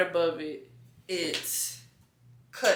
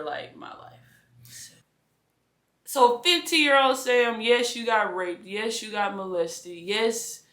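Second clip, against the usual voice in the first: ordinary delivery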